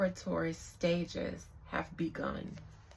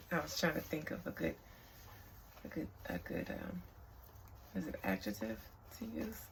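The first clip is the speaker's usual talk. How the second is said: normal talking voice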